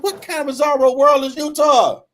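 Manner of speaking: baffled outrage. Retold or imitated. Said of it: "I'm like".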